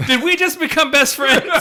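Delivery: loud, high-pitched burst of delight; hammed-up instant-friendship glee; mock gleeful excitement. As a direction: shout it like a thrilled new buddy. Voices Batman suddenly befriending Superman.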